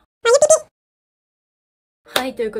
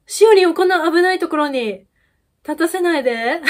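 louder, higher playful alarm; mock protective outcry; 5 semitones higher, 8 dB louder than usual. Real, not acted. Overprotective fan scolds the video, playful alarmed outcry.

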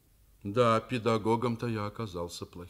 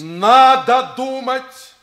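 much louder, higher, slow yell; furious shout; 11 semitones higher, 16 dB louder, much slower than usual. Acted. Father-teacher scolding the class, shouting in anger. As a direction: bellow the rebuke at them.

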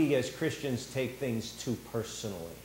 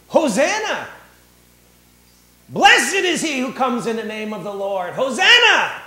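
high, loud jubilant cry; joyful shout; 9 semitones higher, 11 dB louder than usual. Retold or imitated. Preacher voicing the Palm Sunday crowd shouting loud, high praise.

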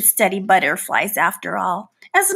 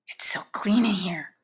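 whisper; 5 semitones lower, 8 dB quieter than usual. Acted. Trapped fly disgusted whisper.

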